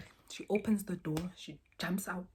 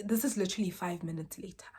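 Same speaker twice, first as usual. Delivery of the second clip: ordinary talk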